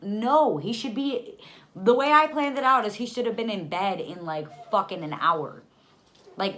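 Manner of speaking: loud, high-pitched indignation; worked-up, incredulous venting; furious rant. Retold or imitated. Mother rejecting advice about her baby, furious rant.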